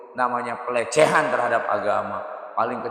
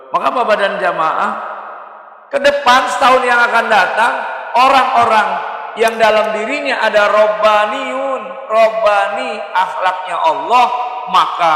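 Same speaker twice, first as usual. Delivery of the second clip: loud, raised-pitch sermon delivery; forceful preaching; 7 semitones higher, 9 dB louder than usual. Real, not acted. Preacher telling the congregation about godly people, forceful. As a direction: drive it home to the whole congregation.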